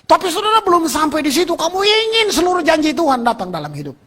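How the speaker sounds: ordinary delivery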